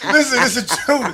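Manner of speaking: laughing and insisting it's true; high, loud laughing insistence. Real, not acted. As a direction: laugh through the words, pleading to be believed.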